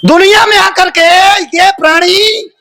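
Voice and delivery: high, ringing declamation; sermon cry, pitched right up; impassioned preaching. Real, not acted. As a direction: proclaim it to the congregation on a high held pitch.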